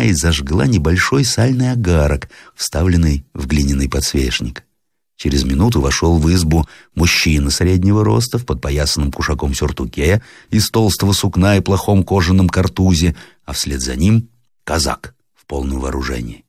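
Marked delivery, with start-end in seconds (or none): none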